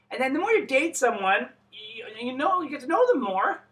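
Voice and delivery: usual speaking voice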